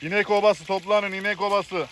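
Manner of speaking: high-pitched calling voice; playfully rally the camp from afar; mock summons.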